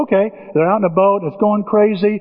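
ordinary talk

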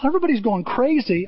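ordinary delivery

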